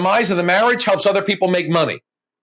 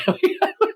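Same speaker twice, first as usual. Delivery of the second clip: high laugh; 13 semitones higher than usual. Real, not acted. Laughs at own imagined pitch.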